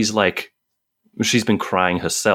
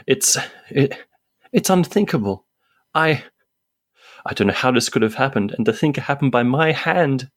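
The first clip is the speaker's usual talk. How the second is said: a hint of a shaken actress; wavering voice; 7 semitones higher than usual. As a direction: voice cracking, a high grieving actress. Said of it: "her voice wavers and she responds".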